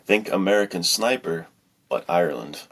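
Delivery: usual speaking voice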